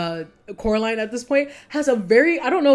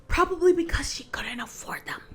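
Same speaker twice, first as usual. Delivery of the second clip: breathy whispered aside, half under the breath; 9 semitones higher than usual. Real, not acted. Vlogger whispering a cheeky aside to viewers, hushed whisper. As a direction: let the joke slip out in a whisper.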